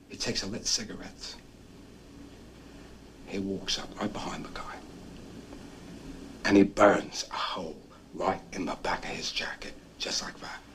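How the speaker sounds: hushed whisper of the prank. Real, not acted. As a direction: whisper it like a secret caper.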